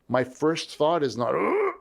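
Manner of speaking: plain lead-in jumping to a high "Ugh!"; sudden high mock groan on "Ugh!"; mock alarmed grunt. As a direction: act a knee-jerk reaction with a high-pitched "Ugh!". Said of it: "when pressure comes up and someone yells".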